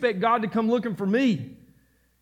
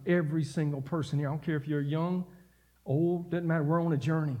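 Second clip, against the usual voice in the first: lower, softer pastoral appeal; gentle exhortation; 5 semitones lower, 4 dB quieter than usual. Acted. Preacher to congregation, a hushed heartfelt plea. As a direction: quietly urge them toward Jesus.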